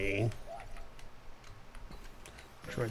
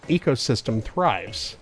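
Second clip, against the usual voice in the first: nature documentary narrator; 7 dB louder than usual. Acted.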